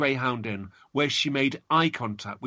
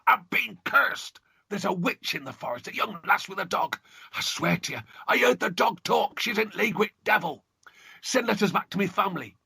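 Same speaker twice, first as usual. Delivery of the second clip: shouted; 7 semitones higher than usual. Acted. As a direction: cry out in terror, high. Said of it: "he shouted".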